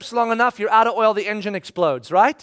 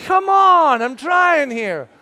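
much higher, loud frustrated cry; exasperated outburst; 6 semitones higher, 5 dB louder, much slower than usual. Retold or imitated. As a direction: vent it, fed up. Frustrated at judging people.

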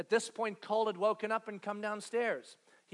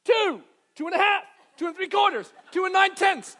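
high, loud count dragging out the numbers; stretched count; 9 semitones higher, 11 dB louder than usual. Retold or imitated. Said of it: "you do this as a parent".

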